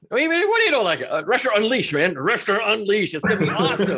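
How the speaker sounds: high, eager pitch for his own title; excited pitch. Retold or imitated. Author defending his title to his editor, eager and high. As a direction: hype up the title, voice jumping high. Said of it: "quoting his own excited reply".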